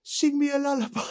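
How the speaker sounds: high, taunting prison-window voice; taunting inmate. Retold or imitated. Neighbour taunts the new boy through the window, high and mocking. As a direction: tease him high and mocking. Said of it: "I went".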